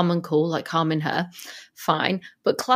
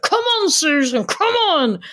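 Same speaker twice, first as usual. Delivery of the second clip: urging Barbara; 7 semitones higher, slower than usual. Retold or imitated. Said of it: "She's like".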